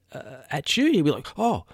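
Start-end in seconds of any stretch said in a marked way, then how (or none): 1.4-1.7 a quick high startled "oh"; the old dog jumping, caught off guard. Retold or imitated. Owner mimicking old dog's startled reaction, mock surprise. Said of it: "like that".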